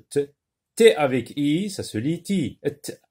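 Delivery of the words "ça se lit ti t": ordinary delivery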